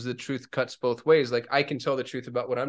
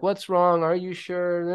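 anxious pleading; 5 semitones higher, 3 dB louder, slower than usual. Retold or imitated. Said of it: "where I'm like".

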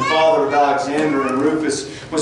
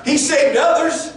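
much higher, scornful taunt; mocking taunt; 13 semitones higher, 4 dB louder than usual. Chief priests mocking Jesus among themselves, high scornful taunt. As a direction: sneer it up high. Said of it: "Can you imagine the mocking".

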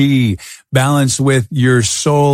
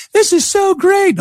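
put-on excited kids. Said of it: "The kids are like".

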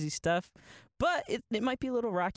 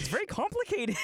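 much higher, put-on solemn voice; mock pompous voice; 9 semitones higher than usual. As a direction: ham up a grave pundit. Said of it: "That one was a little bit better".

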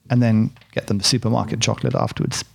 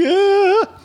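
sudden high loud yelp of fright; panicked yelp; 4 dB louder than usual. Retold or imitated. Stepping into Saigon traffic, sudden panicked yelp. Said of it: "you go out and you go".

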